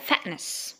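"fatness" with T as a glottal stop; American FATNESS. Shown as a demonstration. Teacher showing American "fatness", glottal-T pronunciation. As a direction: catch the throat: "fa'ness". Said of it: "T زي الهمزة بالظبط".

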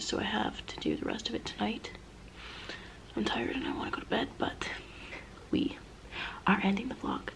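tired late-night whisper. Real, not acted. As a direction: whisper the sign-off softly.

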